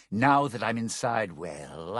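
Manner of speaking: usual speaking voice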